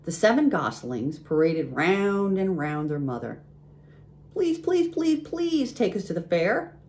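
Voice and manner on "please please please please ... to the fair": very high, squeaky little gosling plea; begging gosling. A narrator's reading. Gosling begs to be taken to the fair, pleading. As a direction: plead in a tiny high-pitched voice. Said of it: "begged a gosling".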